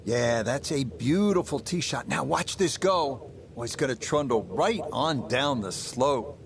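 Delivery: ordinary talk, nothing marked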